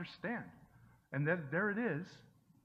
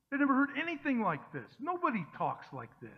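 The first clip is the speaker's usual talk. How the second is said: excited preaching; 7 semitones higher than usual. Acted. Preacher building to astonishment.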